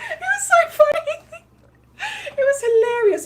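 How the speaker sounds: high, breaking laugh through the words; cracking up at the memory; laughing hard. Real, not acted. Vlogger recalling the funny scene, laughing hard.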